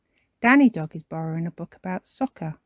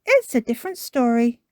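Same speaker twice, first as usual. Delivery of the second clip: higher, louder persuading mum; Mummy Pig; 6 semitones higher, 5 dB louder, faster than usual. Acted. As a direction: coax a child with a lifted voice. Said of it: "says Mummy Pig".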